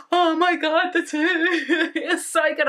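gasping disbelief, playful; mock shocked delight.

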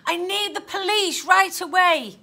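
slow, loud, urgent request. A narrator's reading. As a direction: speak up, high and firm. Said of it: "speaking louder this time".

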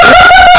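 a sudden high shriek of laughter; cracking up at her own bee story. Real, not acted. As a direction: burst into a loud squealing laugh.

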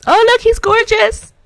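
high and loud gush; delighted squeal. Real, not acted. Player admires the grown dog, delighted squeal. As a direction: gush over him.

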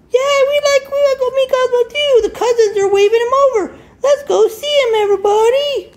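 very high, fast cartoon chatter; squeaky rushing bear. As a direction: rattle it off like a tiny excited bear. Another bear urging everyone.

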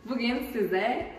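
usual speaking voice

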